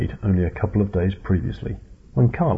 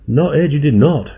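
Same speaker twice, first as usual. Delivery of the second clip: louder, higher retort; shouted back; 6 semitones higher, 6 dB louder than usual. A narrator's reading. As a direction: snap it back across the deck. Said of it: "shouted Carlos back".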